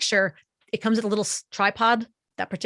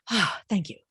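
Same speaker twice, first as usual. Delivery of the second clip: relieved exhale. Acted. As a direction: sigh of relief into a grateful thank-you. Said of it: "if your ears could exhale".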